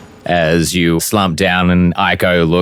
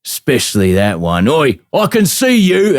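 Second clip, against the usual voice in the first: orc snarling and suspicious, rising to a shout; angry orc guard. Acted. Orc guard catching Gret in the shadows, angry shout. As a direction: bark at the intruders like an orc.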